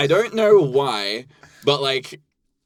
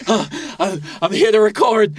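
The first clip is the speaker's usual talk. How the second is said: puffed and eager, higher pitched; mock breathless arrival; out of breath; 7 semitones higher, 3 dB louder than usual. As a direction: act winded after running in. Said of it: "out of breath guy".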